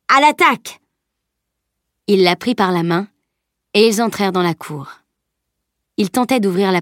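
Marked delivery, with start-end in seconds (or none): 0.1-0.8 high, loud battle cry; rallying cry. Acted. Salim spurring Camille on at the gate, loud rallying cry. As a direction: charge them forward with it.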